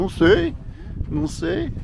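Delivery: mock clueless "não sei", high-pitched; shrugging local. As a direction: imitate a shrugging local, squeaky. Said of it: "é capaz que eles também falam". (imitando morador sem saber, voz aguda)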